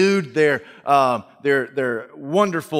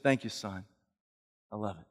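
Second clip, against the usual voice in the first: much softer, lower, deflated; subdued mother; 6 semitones lower, 13 dB quieter, faster than usual. Acted. Mother quietly thanking her son, sad and flat. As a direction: thank him with no joy at all.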